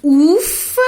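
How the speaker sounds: loud, high "Ufa!" of relief; relieved "Ufa!". Shown as a demonstration. Teacher demonstrating the interjection "Ufa!", relieved. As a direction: blow out "Ufa!" like a weight just lifted. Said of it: "quando eu digo assim".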